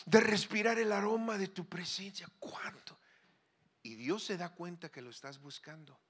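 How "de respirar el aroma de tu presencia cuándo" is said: breathy hushed whisper of longing. Acted. Preacher voicing prayer, falling to a whisper. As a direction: drop to a whisper, intimate with God.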